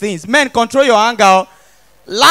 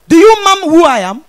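louder, higher self-important demand; indignant challenge; 5 semitones higher, 7 dB louder than usual. Acted. Offended stranger demanding recognition from the comedian, indignant. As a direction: pull rank in a raised voice. Acted quarrel, pulling rank.

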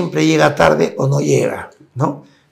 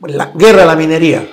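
louder chanted protest cry; mock protest chant, louder; protest slogan; 7 dB louder than usual. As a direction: call it out like a crowd slogan.

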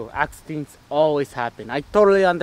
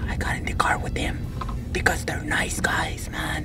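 whisper; 8 dB quieter than usual. Acted. Hiding from gang in back seat.

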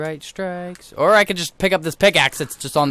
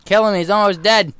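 louder, higher, urging a teammate on; urgent shouting; 6 semitones higher, 5 dB louder than usual. Real, not acted. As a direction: yell at a friend to finish it. Urging teammate to finish mob.

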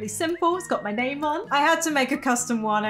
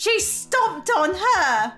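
slow, higher-pitched outrage; indignant parent; 5 semitones higher, slower than usual. Acted. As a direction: drag out the accusation, pitched up. Voicing outraged parent in game.

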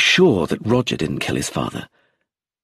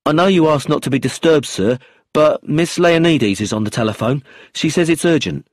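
police officer's voice; 6 semitones higher, 5 dB louder than usual. A narrator's reading.

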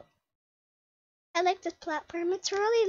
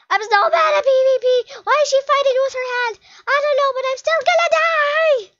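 high, loud, frantic outburst; panicked wail; 5 semitones higher, 8 dB louder than usual. Real, not acted. Gamer about to die in a fight, panicked wail. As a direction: wail louder and higher as defeat closes in.

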